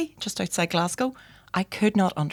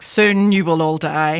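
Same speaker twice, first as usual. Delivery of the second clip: slow, louder doom-laden pronouncement; mock ominous; 5 dB louder, slower than usual. Joking about own personality test answer, mock ominous. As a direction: intone a prophecy of doom, jokingly.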